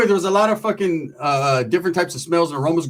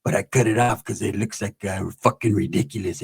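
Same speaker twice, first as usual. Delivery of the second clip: low, soft homie voice; released homie; 8 semitones lower, 6 dB quieter than usual. Retold or imitated. Released homie answering Ricky about his old haircut, low and soft. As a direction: answer low and quiet, playing it cool. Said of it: "He's like".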